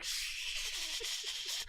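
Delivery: long drawn-out shushing whoosh; sustained airy whoosh; whooshing sound effect. Retold or imitated. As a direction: hiss like something flying through the air. Imitates flying cookie's whoosh.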